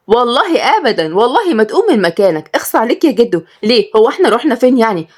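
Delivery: put-on high-pitched, emphatic young woman; insistent protest. Hagar stopping grandfather from coming along, high insistent protest. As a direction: protest sweetly and firmly, voice up.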